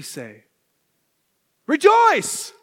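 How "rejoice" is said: sudden high emphatic shout; loud, exuberant. Acted. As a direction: burst out in a loud, exuberant cry. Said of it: "It's loud".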